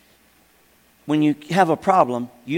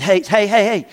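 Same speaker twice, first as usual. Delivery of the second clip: excited calling out. Retold or imitated. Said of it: "so excited about showing the picture".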